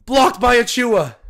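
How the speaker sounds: high, loud shout for the block; excited shout. Real, not acted. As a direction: celebrate the play out loud. Calling a block live.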